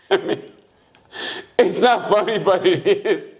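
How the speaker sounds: high, helpless laughter through the words; real laughing. Real, not acted. Admitting the grim story is funny, laughing. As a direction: try to talk while cracking up. Cracking up at own retelling.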